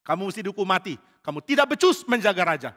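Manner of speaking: high, loud name, then firm scolding; holler, then tell him off; shouted rebuke. Acted. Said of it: "Lalu Daud teriak lagi".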